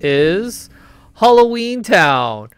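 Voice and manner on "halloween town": slow, high, drawn-out title reveal; announcing title. Acted.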